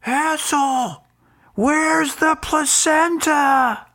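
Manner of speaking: high, drawn-out holler; retold heckler's yell. Host mimicking a fan yelling at him on the field, high holler. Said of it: "he starts yelling at Golic".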